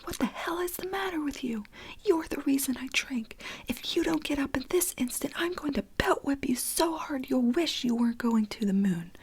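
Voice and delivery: mother screaming at her son on the floor, in a loud high whisper. Acted. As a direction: yell at her but keep it a whisper. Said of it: "Billy to Lisa screaming".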